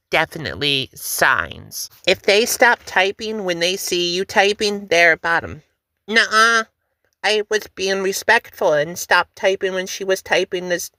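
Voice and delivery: ordinary talk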